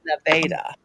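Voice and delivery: "Nevada" with an ay in the middle; local man's pronunciation. Retold or imitated. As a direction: say "Nevada" as nuh-VAY-duh. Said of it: "No, we pronounce it Nevada".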